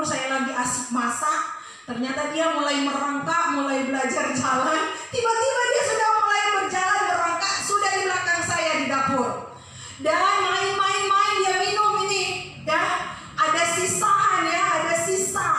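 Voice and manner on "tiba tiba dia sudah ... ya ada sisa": higher, faster telling of the danger; rising preacher's alarm. Acted. Pastor recounting her child's accident, rising alarm. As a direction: build the panic as you tell it.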